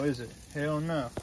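ordinary talk, nothing marked

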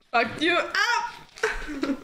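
slow, laughing gloat. Real, not acted. Laughing after bean boozled reveal.

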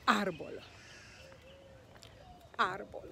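"árbol" stressed on the opening "ár"; first-syllable stress. Shown as a demonstration. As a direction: hit the accented A of "árbol". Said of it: "we emphasize that syllable and we say".

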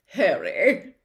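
a vain celebrity professor, loud and chummy; booming, self-satisfied charm; Lockhart voice. Acted.

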